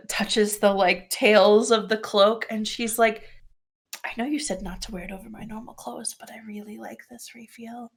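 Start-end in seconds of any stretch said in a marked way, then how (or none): 4.0-7.9 hushed, near-whispered admission in character; quiet confession. Acted. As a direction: say it softly, almost all breath.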